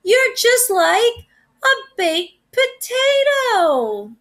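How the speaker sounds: high, slow, doting voice; a lady cooing at her dog. A narrator's reading. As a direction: coo it to a beloved pet.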